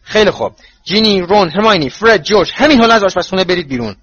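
high, loud, commanding roll-call. A narrator's reading. Mother sends the children out of the kitchen, loudly. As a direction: raise the voice and order them out. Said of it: "با صدای بلند گفت".

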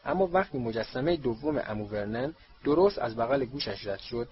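ordinary delivery

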